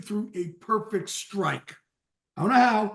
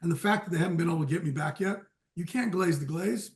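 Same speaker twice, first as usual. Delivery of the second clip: normal talking voice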